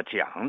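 讲 as "jiǎng" standing for gang; local place-name pronunciation. Shown as a demonstration. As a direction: say 讲 with a j onset and glide. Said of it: "也有把码头读作讲的".

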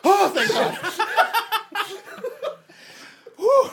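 laughing with loud high relief; relieved laugh. Real, not acted. Player relieved the reset didn't come, laughing. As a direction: breathe out the relief through laughter.

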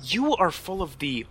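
mock disgust.

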